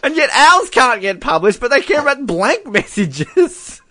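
high-pitched, amused indignation; laughingly aggrieved, high squeal of a complaint; incredulous complaint. Real, not acted. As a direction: protest in disbelief, voice pitched high. Mock outrage at blank messages.

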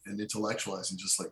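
ordinary talk

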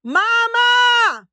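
loud, high holler with drawn-out words; kid calling Mama. Retold or imitated. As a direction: imitate a child yelling through the house. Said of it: "This is what I would hear".

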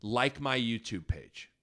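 put-on slow parody of an American accent; drawled-out caricature American, word by word; mock American accent. Host mimics foreigners doing their impression of Americans, mock American accent. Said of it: "do an impression of an American".

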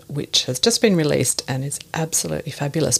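usual speaking voice

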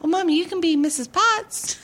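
put-on high, eager little-kid voice handing out roles; imitating eager kids. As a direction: mimic the kids bossily casting the play. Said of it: "You know, it's like".

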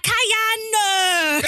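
high laughing yell of a name; laughing shout. Retold or imitated. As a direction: call out the name, cracking up. Imitating host yelling name.

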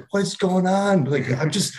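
high-pitched desperate plea, half comic; mock-frantic cry for help in the dark; panicked pleading. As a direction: squeal it out like you're lost and scared. Lost in dark woods, panicking.

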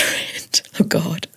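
whispering with a giggle; whispered aside. Real, not acted. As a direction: hush it like a secret.

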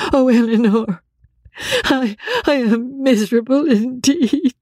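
high, wretched voice near sobs; anguished exclamation. Acted. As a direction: cry it out from a breaking heart. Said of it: "exclaim in the anguish of her heart".

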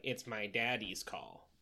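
mock whiny baby Lee. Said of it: "he's also like".

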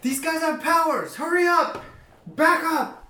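high, panicked guard yelling; alarmed shout. Acted. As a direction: shout a warning to the others. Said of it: "he's like".

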